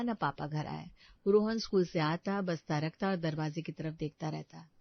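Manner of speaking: plain reading voice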